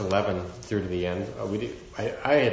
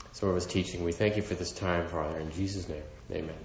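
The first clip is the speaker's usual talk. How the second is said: quiet, reverent prayer voice; 4 dB quieter than usual. Acted. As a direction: pray softly to the Father. Opening prayer.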